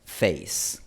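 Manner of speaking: "face" en inglés, con diptongo ei corto y abierto; palabra inglesa. Shown as a demonstration. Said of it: "en la palabra". ("face" en inglés)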